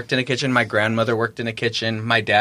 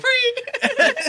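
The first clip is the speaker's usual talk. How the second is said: gleeful squeal. Retold or imitated. Friends begging to make cake.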